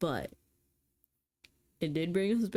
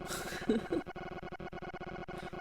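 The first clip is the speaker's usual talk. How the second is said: airy high laugh; 5 semitones higher, 7 dB quieter than usual. Acted. Laughing at the stranger's offer.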